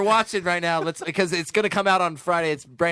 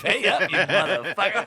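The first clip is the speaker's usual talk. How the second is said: laughing; 2 semitones higher than usual. Pretend. Joking mock call center threat.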